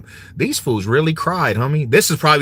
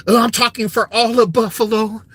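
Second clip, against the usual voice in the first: higher, slowed whine; mock whiny fan; 7 semitones higher than usual. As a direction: whine it like a sore loser. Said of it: "He was like".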